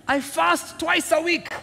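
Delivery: slow, high, self-satisfied prayer; proud pray-er listing his virtues slowly; boastful Pharisee. Acted. Preacher voicing the proud Pharisee, slow and lofty.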